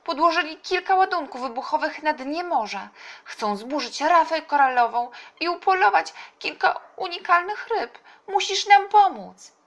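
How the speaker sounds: high, loud, urgent dolphin voice; calling dolphin. A narrator's reading. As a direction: plead loudly as an alarmed dolphin. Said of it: "zawołał delfin". (wysoki, głośny głos przerażonego delfina)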